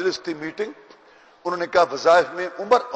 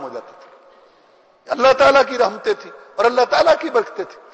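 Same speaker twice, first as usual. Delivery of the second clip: fiery preaching; 4 semitones higher than usual. Acted. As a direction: rouse the congregation. Preaching at full force.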